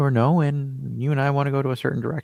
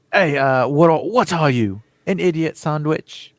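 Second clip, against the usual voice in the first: put-on failed British accent; 6 semitones higher, 6 dB louder, slower than usual. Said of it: "I couldn't do the British accent".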